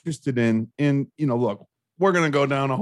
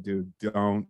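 low, hushed voice in the head; inner voice; 4 semitones lower, 6 dB quieter than usual. Acted. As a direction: murmur a warning to yourself, low. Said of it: "the voice in my head just said".

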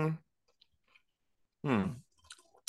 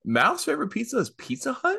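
surprised. Real, not acted. Disbelief at wife's pizza choice.

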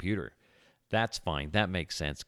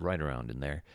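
ordinary talk, nothing marked

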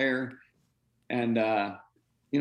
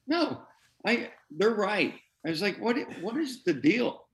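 higher-pitched retold disbelief; baffled protest; 7 semitones higher than usual. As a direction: protest as if wrongly accused. Retells protesting coach's chart criticism.

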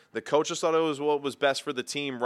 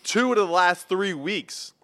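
gloating; 7 semitones higher, 5 dB louder than usual. Real, not acted. Son rubbing his winning record in his dad's face.

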